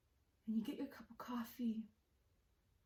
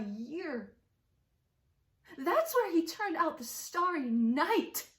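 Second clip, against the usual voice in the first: loud, higher, mounting amazement; awed excitement; 5 semitones higher, 8 dB louder than usual. Acted. Woman marveling at Van Gogh's year of paintings, loud excitement. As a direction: marvel out loud, topping each figure.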